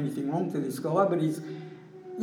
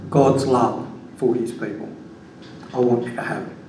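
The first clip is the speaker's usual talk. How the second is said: sincere; 6 semitones lower than usual. Retold or imitated. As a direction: speak it gently as a sincere gift. Telling coworker Jesus loves him.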